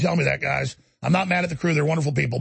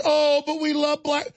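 mock affection; 8 semitones higher than usual. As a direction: gush insincerely, pitched up.

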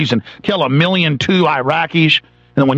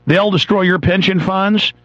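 ordinary delivery

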